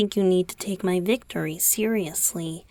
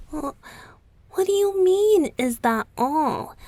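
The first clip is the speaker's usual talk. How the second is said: surprised; 7 semitones higher, 4 dB louder than usual. Acted. Boyfriend startled by the listener's reply.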